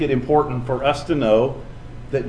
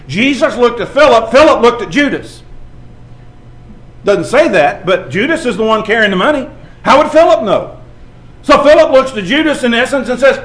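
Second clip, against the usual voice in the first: preaching at full force; 8 semitones higher, 7 dB louder than usual. Acted. Preaching narration.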